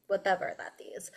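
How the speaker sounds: quick unvoiced whisper; throwaway whisper under her breath; whispered aside. Real, not acted. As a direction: whisper it off to the side, fast. Acolyte's aside about nuns.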